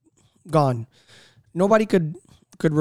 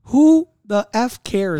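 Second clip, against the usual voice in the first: slow, drawn-out, louder exasperation; exasperated; 5 semitones higher, 5 dB louder, much slower than usual. Real, not acted. Telling a friend nobody should care, slow and louder. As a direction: stretch each word, fed up. Heated talk about Hunter Biden.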